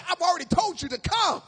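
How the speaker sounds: annoyed, emphatic pulpit outburst; a little annoyed. Acted. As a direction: insist loudly, as if tired of repeating it. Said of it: "Jesus get a little annoyed".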